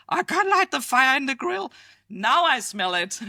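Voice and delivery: high, loud mock whine of a grill owner; whiny complaining neighbour. Danes who can't light the grill complain in a high mock whine. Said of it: "So everybody was like".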